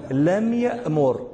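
لم يأمر drawn out slowly, word by word; slow demo. Shown as a demonstration. Teacher slowing down for students learning the rule. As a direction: spell out لم يأمر at half speed. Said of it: "فنقول".

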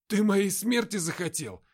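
loud, high, slow outcry; angry outburst. Acted. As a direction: blurt it out in fury. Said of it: "выпалил Тёма".

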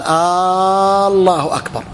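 الله أكبر with a very long held "aa" at the start; lengthened opening hamza. Shown as a demonstration. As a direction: drag the first vowel of الله into a long steady "aaaa", then finish أكبر. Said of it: "أو مد همزة الله".

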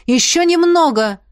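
loud, high encouraging call. A narrator's reading. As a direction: call down to climbers below. Calling encouragement from above.

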